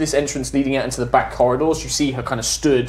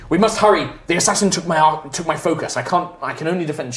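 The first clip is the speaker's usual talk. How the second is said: urgent woman; 4 semitones higher, faster than usual. Acted. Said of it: "She's just like".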